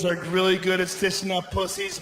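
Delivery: ordinary delivery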